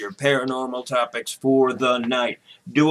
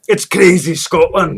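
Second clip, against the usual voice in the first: put-on Scottish accent; 4 semitones higher, 5 dB louder, slower than usual. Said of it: "American's version of a Scottish person".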